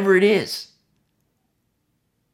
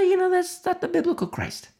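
lightly voiced reply; 13 semitones higher than usual. Answering in a different voice.

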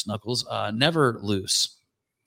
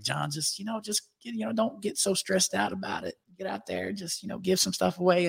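imitated Southern drawl, much higher and softer; lightly mock Craig's Southern calm; friend's Southern drawl telling him not to stress; 8 semitones higher, 3 dB quieter than usual. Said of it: "he says that all in a Southern drawl".

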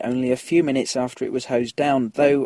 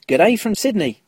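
broad mock Australian "G'day", higher and louder; Australian accent; 7 semitones higher, 5 dB louder than usual. Shown as a demonstration. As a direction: put on an over-the-top Australian accent for "G'day from Sydney". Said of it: "Wasn't that awful?".

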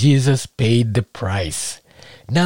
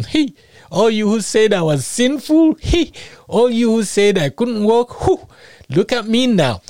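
high, bright crowing at the crowd; gleeful taunt; 6 semitones higher than usual. Retold or imitated. Paralytic taunting his accusers, high gleeful crowing. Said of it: "this paralytic looking back at him".

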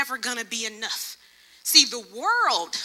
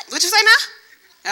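high, loud, disbelieving "what you say now"; Pastor Jay's reaction; 8 semitones higher, 6 dB louder, slower than usual. Retold or imitated. Pastor stunned by her confession, high laughing disbelief. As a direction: mimic his stunned, laughing double take. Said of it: "that's exactly how he said it".

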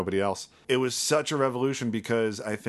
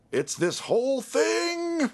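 drawn-out, high-pitched amazement; wowed and stretching every word; amazed reaction; much slower than usual. Retold or imitated. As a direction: gush slowly, voice soaring way up. Acting out amazed first reaction.

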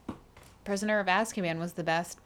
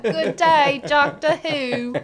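loud, higher, slow Australian greeting; laughing parody of an Australian goodbye; imitating the staff in a cartoon Australian voice; mock Australian accent; 7 semitones higher, 8 dB louder, much slower than usual. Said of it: "They were like".

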